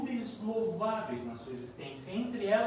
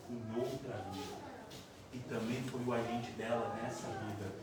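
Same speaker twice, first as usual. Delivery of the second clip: hushed loving rebuke from a luminous spirit; tender spirit mother; 8 semitones lower, 8 dB quieter than usual. Retold or imitated. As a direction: speak to him like a grieving child, very gently. Loving spirit gently rebukes Camilo.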